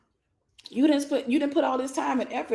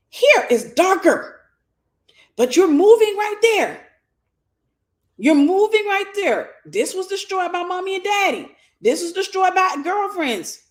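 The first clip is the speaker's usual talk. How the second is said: preaching at full force; 8 semitones higher, 4 dB louder than usual. Acted. Preacher urging the women listening, at full force. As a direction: build each line like a sermon climax.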